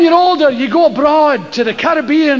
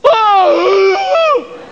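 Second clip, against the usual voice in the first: high strangled startled cry; fright yelp; 7 semitones higher than usual. Retold or imitated. Body's involuntary scream when shot at. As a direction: let out a sudden fright cry.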